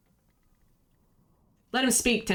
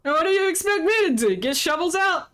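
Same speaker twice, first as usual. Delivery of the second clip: higher, indignant scoff; scoffing captain; 8 semitones higher than usual. Acted. Captain scoffing at the crew's demand, higher incredulous voice. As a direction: throw the question back in disbelief.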